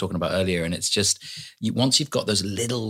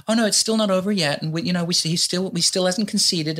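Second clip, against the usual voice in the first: high-pitched mock press secretary; imitated spokesperson in a high voice; 10 semitones higher than usual. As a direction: retell it way up high, in protest. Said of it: "his press secretary the other day".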